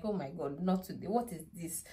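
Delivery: soft, broken-down murmur; tearful despair. Retold or imitated. Mother retelling her reaction to the missed appointment, tearful and soft. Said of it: "I just started to cry".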